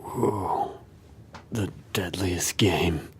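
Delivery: soft whispered, slow and spooky; ominous whisper. Acted. Reacting to the hunter story in a slow spooky whisper. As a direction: whisper it like a horror trailer.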